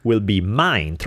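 ordinary delivery

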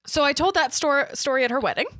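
normal talking voice

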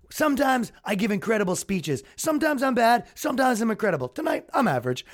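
mock politician quote. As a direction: rate yourself as if addressing a rally. Quoting Trump's speech as impression.

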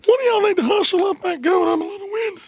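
high, strained put-on country voice; hammed-up goat thrower. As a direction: play a winded thrower in a high voice.

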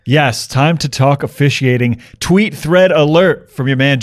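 ordinary talk, nothing marked

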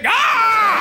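sudden high yelp; high shout. Acted.

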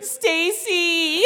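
very high laughing cry of Stacy; desperate call. Retold or imitated. Woman being lashed calling her friend, high desperate cry. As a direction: squeal her friend's name.

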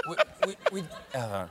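high, smooth James Mason as Humbert; purring, wheedling English gentleman; James Mason impression. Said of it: "what's your James Mason".

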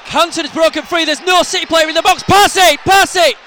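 high, loud, rising to a yell of Pass it!; excited commentary building to a shout. Real, not acted. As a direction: urge the player to pass. Live attack, urging a pass.

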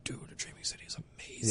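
soft, half-whispered amazement; hushed awe. Retold or imitated. Player watching the Dreaming City for the first time, hushed awe. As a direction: imitate someone quietly awestruck, almost under the breath. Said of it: "in awe in a lot of times".

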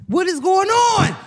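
high, slow, drawn-out protest; exasperated outcry. Real, not acted. Host protesting the camera on her, exasperated outcry.

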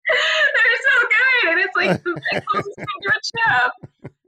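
high, helpless laughing talk. Real, not acted. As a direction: squeeze the words out through real laughter. Cracking up over snack confession.

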